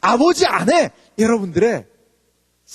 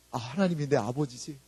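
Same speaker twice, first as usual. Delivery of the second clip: ordinary delivery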